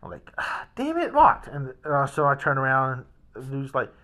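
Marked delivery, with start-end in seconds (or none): 0.4-1.5 high exasperated cry, louder on locked; frustrated outburst. Retold or imitated. Man finding the front door locked too, high exasperated cry. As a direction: vent at yet another locked door.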